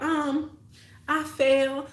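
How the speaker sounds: put-on high, hesitant child's voice; a small kid fumbling a lie; nervous little kid. Child lying to mom.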